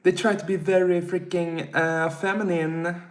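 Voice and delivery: a hint of a mock camp voice; mocking feminine voice. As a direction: tease them with a dainty voice. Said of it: "They talk like this".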